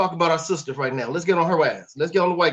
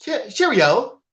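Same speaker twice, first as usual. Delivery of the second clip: put-on British cheerio, higher; mock British accent; 4 semitones higher, 4 dB louder, much slower than usual. Echoing the co-host's joke, mock British accent. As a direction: stumble into a playful British lilt. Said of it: "damn near have a British accent".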